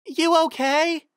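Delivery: high, slow, gentle pony voice; Carrot Top's voice. A narrator's reading. Narrator voicing Carrot Top asking a giant friend, high and slow.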